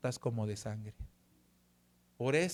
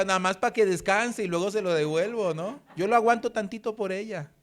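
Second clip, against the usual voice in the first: louder, higher, playful self-mocking bravado; tongue-in-cheek heroic pledge; mock noble offer; 6 semitones higher, 7 dB louder than usual. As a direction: ham up the generous husband with a wink. Husband jokingly voicing his own thought.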